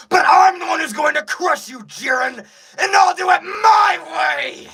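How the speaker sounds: full-force high shout, pushing against a huge weight; straining angry Vegeta. Acted. Voice actor performs Vegeta charging his attack, straining angry shout. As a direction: roar it as if lifting something very heavy. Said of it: "he's gotta sound like he's, like, really, like, lifting something".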